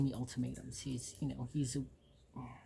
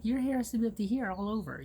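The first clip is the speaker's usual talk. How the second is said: higher, louder imitation of a school scold; mock scolding official; 8 semitones higher, 5 dB louder than usual. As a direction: parody a strict school official laying down rules. School officials lecturing about hair.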